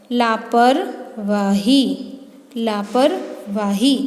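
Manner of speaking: लापरवाही stretched out, ending on a rise; slow modelling. Shown as a demonstration. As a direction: draw out लापरवाही so the ई is heard. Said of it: "teacher models the derived word". (लापरवाही धीरे-धीरे)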